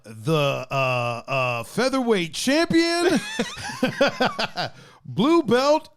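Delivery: high, laughing champion announcement; ringmaster. Pretend. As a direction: build it up like a ring announcer with laughter.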